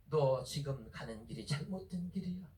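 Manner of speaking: low, grave divine voice; God's warning. Acted. God warning Saul he is on the wrong road, low and solemn. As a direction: drop deep to deliver a warning.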